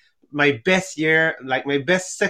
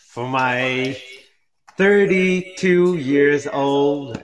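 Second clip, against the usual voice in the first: ordinary delivery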